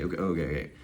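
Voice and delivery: low, soft reassuring voice; calm self-talk. Acted. The speaker calming himself out of panic, low and soft. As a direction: talk yourself down gently. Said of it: "reality check for myself".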